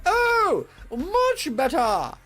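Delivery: very high, loud and slow; high loud slow queen cry; delighted cry. Acted. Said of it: "cried the Queen".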